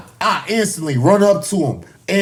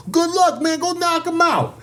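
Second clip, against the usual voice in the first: lightly voiced coach, pitched up; quoting the boxing coach; 10 semitones higher than usual. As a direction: imitate a send-off in a higher voice. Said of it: "That's his exact words".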